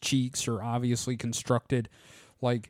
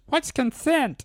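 high-pitched put-on dolphin voice; mock dolphin; 12 semitones higher than usual. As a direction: pipe it up high as a joking dolphin. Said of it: "all you ever hear from dolphins is".

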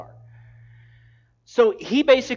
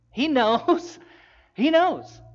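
laughing emphasis; 8 semitones higher, 6 dB louder, much slower than usual. Acted. Emphatic repeated claim.

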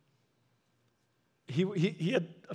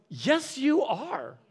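higher, slow, emphatic; firm insistence; 6 semitones higher, much slower than usual. Retold or imitated. Pastor insists the old man is still needed, emphatic and slow. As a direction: press each word home gently.

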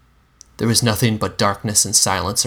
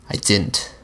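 ordinary delivery

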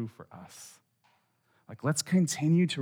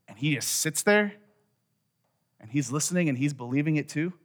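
ordinary talk

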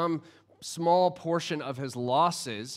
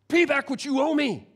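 higher, louder harsh demand; angry demand; 9 semitones higher, 5 dB louder than usual. A narrator's reading. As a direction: bark the demand at him. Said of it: "he demanded".

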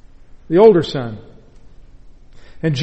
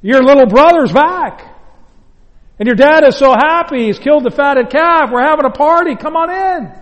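bright, higher, eager good news; happy servant; 5 semitones higher than usual. Acted. Preacher voicing the servant with glad excitement. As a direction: announce the joyful homecoming to the brother. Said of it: "And the servant said".

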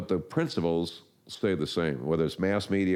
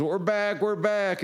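far higher, ecstatic repeated cry; jubilant mock cheer; slower than usual. Salesman celebrating soaring sales, hammed-up jubilant cheer. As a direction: cheer like the company was saved. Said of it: "and he goes".

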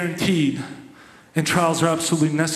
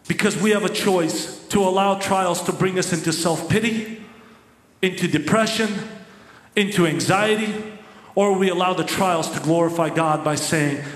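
normal talking voice

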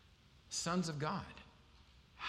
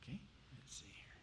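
quick whispered aside, much softer; 14 dB quieter, faster than usual. Real, not acted. Pastor mutters to himself finding the slide, whispered. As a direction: whisper it to yourself while fumbling for a picture.

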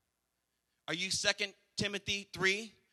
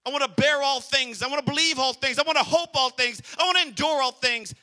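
louder and higher, each line building; rising preaching; 5 semitones higher, 5 dB louder than usual. Acted. Preacher swearing love to the congregation, building loud. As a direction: preach it like a vow to the church.

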